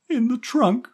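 put-on very high child's voice; little boy. A narrator's reading. Stevie telling the woman where the cat was, little boy. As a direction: pipe up loudly like a small boy. Small boy answers, pointing.

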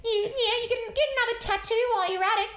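high, fast, loud mocking old lady voice; old lady mocking the speaker's piercings and tattoos. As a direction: jeer like a nasty old woman, way up high. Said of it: "she's like".

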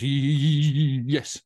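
loud, stuttering shiver on the first sound; teeth-chattering stammer, hammed up for a joke; shivering parrot. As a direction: act a freezing parrot struggling to get the word out. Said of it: "the parrot's shivering in the fridge".

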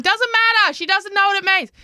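put-on high dismissive teen voice; imitating daughter. Mother mimicking her daughter brushing her off, high. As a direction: wave it off like a know-it-all teen. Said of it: "And Darlie's going".

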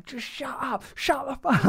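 very high, squeezed and held-back exasperation; strained high voice, as if stifling a yell; exasperated mock outburst. Dad wishing he could snap at his small child, exasperated mock outburst.

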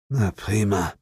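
plain reading voice

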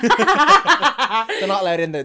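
words broken by giggles; laughing. Real, not acted. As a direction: laugh through the line. Laughing through joke.

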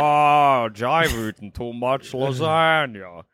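fat, lazy cartoon voice; slow, low, overfed groan; Homer Simpson. Garfield as a cartoon husband whining to his wife, fat lazy drawl. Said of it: "He's got fat, lazy voice".

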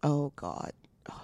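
soft, breath-only groan of dismay; whispered dread. Real, not acted. Listener hearing the story turn, whispered dread.

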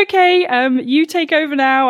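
high, giggly and rattled, giving up the wheel; flustered laughing handover. Retold or imitated. Nervous learner laughing, telling the instructor to take over. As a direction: laugh it off nervously and hand back control. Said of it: "I just said".

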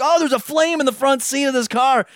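high, excited bystander exclamation; alarmed onlooker. Retold or imitated. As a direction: call it out like a gawking onlooker. Voicing bystanders filming the burning car.

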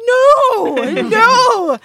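high, loud, hammed-up refusal; loud protest. Reluctant actor protesting to her cheering friend, loud protest. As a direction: wail the objection in mock dismay.

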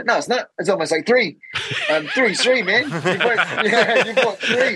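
high, laughing ribbing of a boastful rival; laughing mockery. Real, not acted. Fighter laughing at the French guy's three fights, in high laughing mockery. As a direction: laugh through the words, teasing him hard.

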